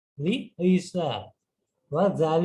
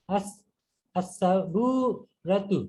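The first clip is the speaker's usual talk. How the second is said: আস-সাবুরাতু drawn out very slowly, syllable by syllable; careful pronunciation; much slower than usual. Shown as a demonstration. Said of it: "আস-সাবুরাতু উচ্চারণ করতে আমার যে ইয়া, কষ্টটা".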